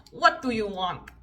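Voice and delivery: put-on slow, mean Russian hairdresser accent. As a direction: imitate a curt Russian lady's accent. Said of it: "I keep doing that accent".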